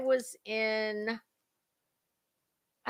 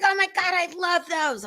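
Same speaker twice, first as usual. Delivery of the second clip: put-on much higher, gushing girly squeal; gushing sister-in-law; 10 semitones higher than usual. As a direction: play the thrilled sister-in-law swooning over nails. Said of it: "She's like".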